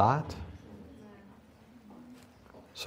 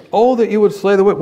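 raised, loud declaimed quote; psalmist's outcry, quoted; 7 semitones higher, 9 dB louder than usual. Acted. Teacher re-quotes psalmist for students, loud declaiming cry. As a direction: call it out high and loud as a quotation.

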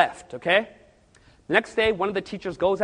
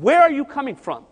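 higher, louder demand; demanding question; 7 semitones higher, 7 dB louder than usual. Retold or imitated. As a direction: interrogate the demon sharply. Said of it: "And he said".

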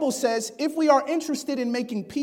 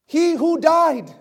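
emphatic proclamation; 4 semitones higher, 6 dB louder, slower than usual. Acted. Climactic offering of Christ.

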